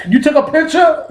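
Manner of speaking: very high, incredulous squeal of a question; hammed-up high-pitched disbelief; mock shock. Man finding out he was photographed, high shocked voice.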